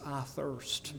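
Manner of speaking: very soft, drained, barely voiced; a hushed, weak "I thirst"; faint dying whisper. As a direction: let it fall away like a last breath. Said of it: "He says".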